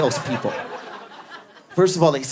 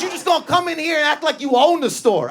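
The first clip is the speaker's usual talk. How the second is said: pushy salesman; 9 semitones higher than usual. Retold or imitated. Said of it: "He goes".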